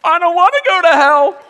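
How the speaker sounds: high, loud mock wail; hammed-up panicked protest; terrified child, imitated. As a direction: cry out like a scared little brother. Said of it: "His face went pale".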